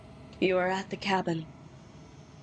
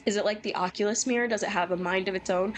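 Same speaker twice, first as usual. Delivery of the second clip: ordinary talk, nothing marked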